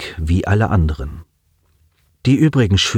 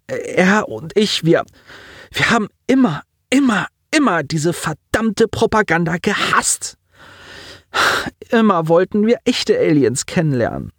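put-on high, strained voice of a grieving boy; a young man fighting back tears; lightly voiced teen on the edge of crying; grieving boy Simon; 9 semitones higher than usual. A narrator's reading.

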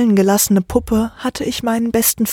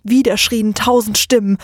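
plain reading voice

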